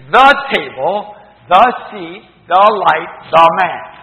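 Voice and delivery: each THE punched, loud and slow; stress on THE. Shown as a demonstration. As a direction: hit every THE as if pointing at one thing. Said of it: "I am saying".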